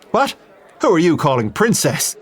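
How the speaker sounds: Irish priest taking offence; bristling Irish priest. Acted. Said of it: "The Irishman bristled".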